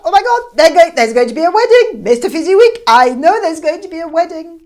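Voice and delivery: high, loud, gushing with excitement; excited. Acted. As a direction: squeal it out like joyful gossip. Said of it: "Missus Fezziwig was so excited".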